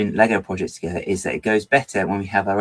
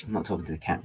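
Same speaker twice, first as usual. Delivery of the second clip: usual speaking voice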